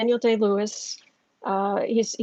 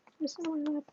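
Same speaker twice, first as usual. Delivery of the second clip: high pet talk; 6 semitones higher, 8 dB quieter, faster than usual. Real, not acted. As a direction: squeak the question up high to the dog. Asking the dog again.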